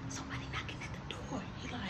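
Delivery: quick hushed whisper. Retold or imitated. Warning his friend in a quick whisper. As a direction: whisper it fast so nobody hears.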